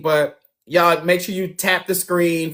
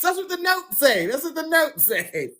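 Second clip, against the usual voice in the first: giggly, higher, amused defense; half-laughing protest; 9 semitones higher, 6 dB quieter than usual. Real, not acted. Host defends himself, laughing.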